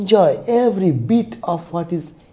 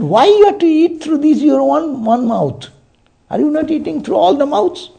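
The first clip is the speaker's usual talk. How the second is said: high-pitched, incredulous questioning; imitating an exasperated mother's reproach; retold rebuke; 12 semitones higher, 5 dB louder than usual. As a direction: scold with a challenge, voice pitched way up.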